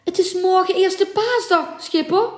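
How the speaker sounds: mate calling back high and loud; loud protesting call. A narrator's reading. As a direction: shout it back across the deck. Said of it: "riep de stuurman terug".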